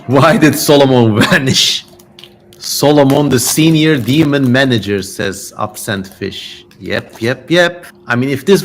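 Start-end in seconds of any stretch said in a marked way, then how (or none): 0.1-5.0 reads the question aloud through a laugh; laughter breaking into the words; laughing while reading. Real, not acted. Host reading a viewer's joke to chat, laughing.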